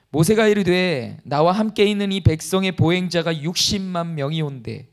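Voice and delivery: loud, solemn Bible reading; scripture reading. Acted. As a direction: read the verse out to the congregation. Reads scripture aloud. (힘 있게 성경 봉독)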